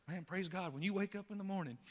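loud, high, sing-song churchy cheer. Retold or imitated. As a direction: ham up the relentlessly upbeat believer. Mocking chipper churchy greeting.

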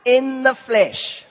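loud, laughing mock gloat; gloating surprise. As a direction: pop up and crow at him with a laugh. Risen Jesus mockingly surprises Peter.

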